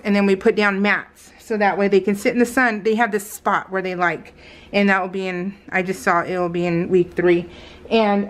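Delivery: ordinary delivery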